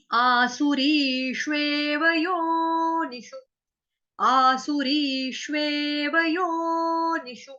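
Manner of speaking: drawn out, every syllable of आसुरीष्वेव योनिषु held; slow chanted Sanskrit line; slow recitation. Shown as a demonstration. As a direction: chant it slowly for students to repeat. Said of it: "इसको तोड़ तोड़ के पढ़ेंगे".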